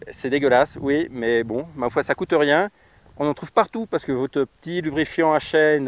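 ordinary talk, nothing marked

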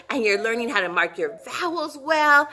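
ordinary talk, nothing marked

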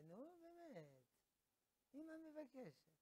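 high, soft wheedling; mock motherly begging; pleading mother. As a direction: coax in a high, small voice. Mother pleads about a match.

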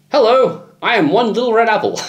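high, sing-song Hello, I am one little red apple; high sing-song tourist voice; mock French tourist. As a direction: voice it like a lost tourist. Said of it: "I used to say that".

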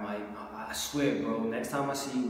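ordinary talk

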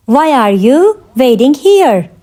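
measured, word-grouped question; slow teaching repeat. Shown as a demonstration. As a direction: separate it clearly for learners. Teacher repeats question slowly for learners.

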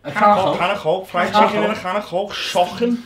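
put-on Scouse accent. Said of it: "The next accent is Scouse".

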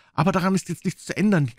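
put-on high-pitched, impassioned appeal to the boy; urgent pleading doctor. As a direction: urge him to jump and run, pitch held high. Doctor urges Jim to escape.

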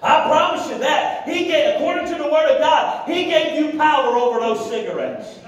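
high, emphatic pulpit exhortation; fiery preaching. Real, not acted. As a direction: drive each phrase home to the congregation. Preaching at full force.

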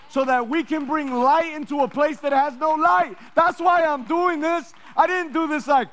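loud, high, building preacher's cry; preaching at full force. Real, not acted. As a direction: drive it home to the church, slowing at the end.

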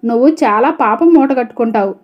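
ordinary delivery

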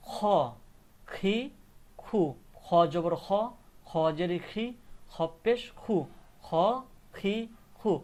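খ, খি, খু recited loud and separate; pronunciation drill. Shown as a demonstration. Teacher repeating kha sounds for learners, pronunciation drill. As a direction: call out খ, খি, খু one by one. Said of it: "কেমন উচ্চারণ হবে".

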